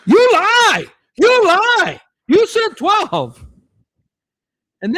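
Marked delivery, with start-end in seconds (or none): 0.0-3.5 loud, high outraged yell; screaming dad. Retold or imitated. Dad accusing the man behind the counter, screaming. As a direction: scream the accusation, drawing out the words. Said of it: "my dad starts screaming".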